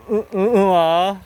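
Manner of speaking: drawn-out disgusted groan. Real, not acted. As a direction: groan at the smell. Reacting to smell.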